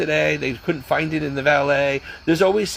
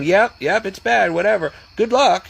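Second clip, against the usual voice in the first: higher, louder, brushing her off; dismissive doctors; 5 semitones higher, 3 dB louder than usual. Retold or imitated. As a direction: toss it off like a bored ER doctor. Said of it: "and they keep saying".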